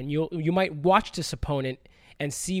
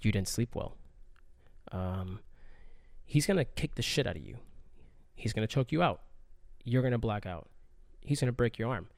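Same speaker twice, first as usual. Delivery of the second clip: inner demons voice; 5 semitones lower, 6 dB quieter than usual. Retold or imitated. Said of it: "They're like".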